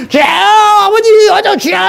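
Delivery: high, wailing mock prayer cry; mock tearful prayer. Preacher mocking young people praying at the microphone, high wailing cry. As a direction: parody a weeping worshipper crying out to God. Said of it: "이러고 왜 눈물까지 짜가면서".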